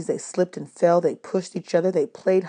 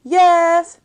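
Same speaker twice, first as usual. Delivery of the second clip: put-on children answering; 11 semitones higher, 9 dB louder than usual.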